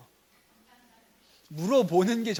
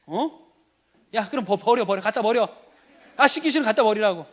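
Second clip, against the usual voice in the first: acted angry outburst, louder and higher; exasperated ordinary father; 4 semitones higher, 8 dB louder than usual. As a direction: play a dad losing his temper. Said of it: "이게 보통 아빠고요". (버럭 화내는 아빠 연기)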